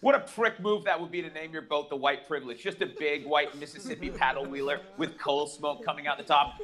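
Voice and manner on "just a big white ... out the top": ordinary delivery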